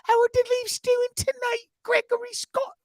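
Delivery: very high, slow British mum voice; high squeaky mum accent asking about the game; mom's accent. Retold or imitated. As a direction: pipe up sweetly like his English mum. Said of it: "you can't do my mom's accent".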